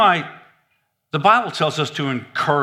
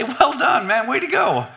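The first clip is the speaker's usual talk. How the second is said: higher-pitched acted congratulations; enthusiastic praise; 7 semitones higher than usual. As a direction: cheer a friend on for trying. Acting out praise for fellow Christians.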